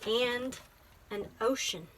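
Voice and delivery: gentle, measured picture-book reading; teacher reading a poem book aloud to a child; reading to children. Acted. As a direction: read it like story time.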